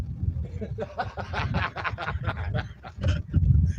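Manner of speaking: high, sustained cackling laugh; real long laugh. Real, not acted. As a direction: let a long laugh run out. Laughing during motor chat.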